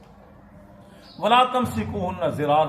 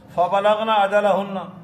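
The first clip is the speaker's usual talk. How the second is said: slow loud recitation; 5 semitones higher, 7 dB louder than usual. Acted. Reciting a Quran verse.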